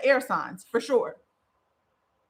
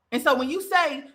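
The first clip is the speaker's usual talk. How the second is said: usual speaking voice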